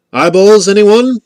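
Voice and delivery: high, loud call, like a vendor; loud, lifted questioning call. A narrator's reading. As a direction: sing out the offer across the dark. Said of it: "came a call".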